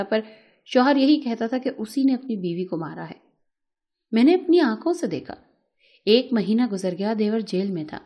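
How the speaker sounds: plain reading voice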